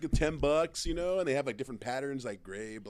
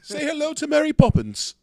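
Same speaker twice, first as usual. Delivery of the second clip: high, loud, hammed-up English accent; silly English accent pushed up high; mock English accent; 11 semitones higher, 5 dB louder than usual. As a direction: play a cartoon Englishman at high pitch. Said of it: "I said English accent".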